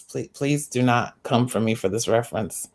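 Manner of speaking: ordinary talk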